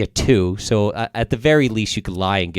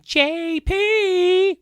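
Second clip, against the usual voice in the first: very high, sustained mock holler; booming mum call. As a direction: imitate a mother calling her kid from afar. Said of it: "I would get the big booming".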